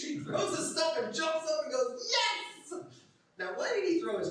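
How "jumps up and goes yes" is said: high-pitched triumphant outburst; hammed-up jubilant yell; elated. Beggar jumps up to go to Jesus, elated. Acts out blind man leaping up.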